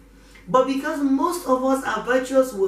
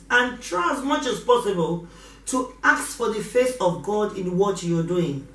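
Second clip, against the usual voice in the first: ordinary delivery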